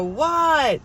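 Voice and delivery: high, loud call back; yelled reply. Retold or imitated. Kid answering mom after turning music down, acted yell. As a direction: holler back as a kid would.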